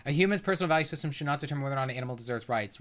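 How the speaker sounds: rattled off at double speed; fast mocking recital; rapid rereading. Shown as a demonstration. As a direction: read it back quickly, mocking. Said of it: "reads the statement back fast".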